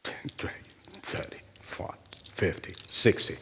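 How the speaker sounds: counting coins in a whisper. Acted. Counting out coins under breath.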